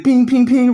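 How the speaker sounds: high loud pings; ping ping like ricochets; comic ricochet noises; bullet pings. Retold or imitated. Bullets bouncing off Superman.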